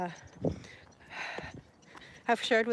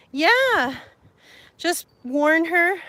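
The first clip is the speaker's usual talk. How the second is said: loud; 6 semitones higher, 7 dB louder, much slower than usual. Real, not acted. Playful warning to a viewer.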